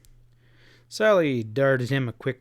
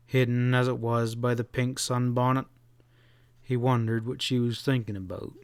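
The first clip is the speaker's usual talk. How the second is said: usual speaking voice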